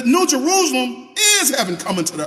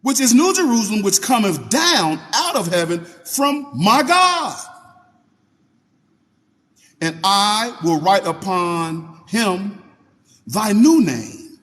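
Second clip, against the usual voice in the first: slow scripture reading; slower than usual. Acted. Reads scripture aloud.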